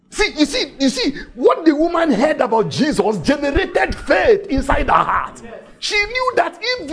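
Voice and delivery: preacher building to a climax; preaching at full force. Acted. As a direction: drive it home to the congregation.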